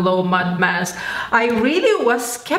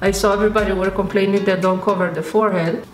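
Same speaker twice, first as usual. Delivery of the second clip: speaking through mask mouth hole; talking while pressing mask on; wearing a face mask. Real, not acted. Seen 2: Thick full-face mask worn throughout; mouth opening leaves lips uncovered.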